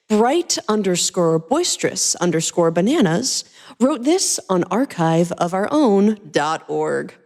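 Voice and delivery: normal talking voice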